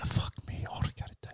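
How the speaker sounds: exhausted breathy whisper. Retold or imitated. Hearing the repair price, whispered in despair. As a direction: whisper it like you have nothing left.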